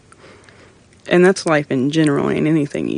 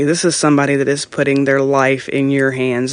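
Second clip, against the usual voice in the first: ordinary talk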